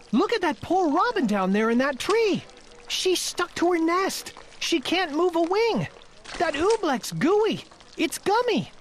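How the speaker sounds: put-on a boy pointing out a stuck robin; young Bartholomew, worried.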